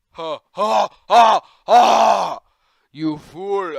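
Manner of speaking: loud triumphant evil laugh; villain laugh. Acted. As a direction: cackle like a victorious villain.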